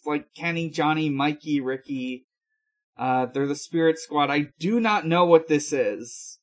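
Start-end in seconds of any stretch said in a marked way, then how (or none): none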